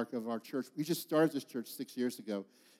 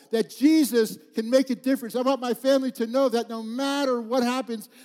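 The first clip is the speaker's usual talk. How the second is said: raised, ringing preacher's quote, higher and louder; passionate preaching; 7 semitones higher, 7 dB louder than usual. Retold or imitated. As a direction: preach her words out full force. Preacher voicing dying woman, rising.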